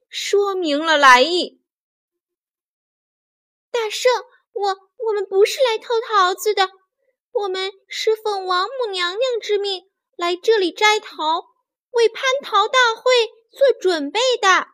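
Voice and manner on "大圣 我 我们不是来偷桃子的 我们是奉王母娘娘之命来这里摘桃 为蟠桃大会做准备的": high, hurried pleading voice; frightened fairy. A narrator's reading. Fairies kneeling before the Monkey King, frightened pleading. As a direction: explain anxiously in a high voice. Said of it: "仙女们吓得赶紧下跪".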